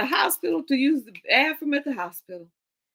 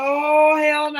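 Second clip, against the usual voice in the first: louder hell no; emphatic refusal; 5 semitones higher, 6 dB louder, slower than usual. Retold or imitated. Me deciding not to go after the warning, loud refusal. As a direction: throw it out, dead set against it.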